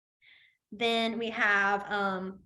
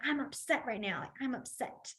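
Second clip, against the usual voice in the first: softer, subdued upset; 12 dB quieter than usual. Shown as a demonstration. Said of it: "So you wanna be like".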